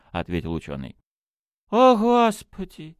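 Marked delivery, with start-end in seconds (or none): 1.7-3.0 high, slow, loud cry of amazement; astonished exclamation. A narrator's reading. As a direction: exclaim like a stunned little priest. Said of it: "воскликнул священник". (поражённое восклицание)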